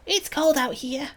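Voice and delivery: put-on high, childlike character voice; high-pitched small-creature complaint; young companion complaining.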